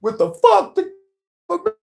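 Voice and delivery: high, loud announcer yell for the podcast title; hype show intro. Real, not acted. Host opening his podcast with a loud announcer yell. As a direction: belt out the show name like an MC.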